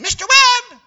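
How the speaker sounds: high, loud call from below. Retold or imitated. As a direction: call his name up to the pulpit. Unseen lady calling out again.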